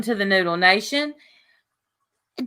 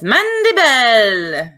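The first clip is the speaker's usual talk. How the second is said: high, stretched mock-accented call; German lady; 4 semitones higher, 3 dB louder, much slower than usual. Imitating German lady for co-host, drawn-out high call. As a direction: draw the name out in a hammed-up accent. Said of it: "German lady says".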